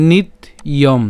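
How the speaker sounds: "নিত ইয়ম" broken into nit, pause, iyom; "নিত ইয়ম" with a clear break before the y sound; split pronunciation. Shown as a demonstration. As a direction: say "নিত ইয়ম" as two separate pieces. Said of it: "ভেঙে উচ্চারণ করি".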